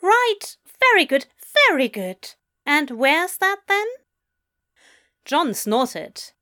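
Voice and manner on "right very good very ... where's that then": high British voice, politely puzzled; precise British accent. A narrator's reading. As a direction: acknowledge and ask in a precise, raised British voice. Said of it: "the precise British voice".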